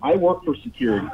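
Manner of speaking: caller on a phone line; thin call-in phone sound; over the phone. Real, not acted. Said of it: "we got another phone call".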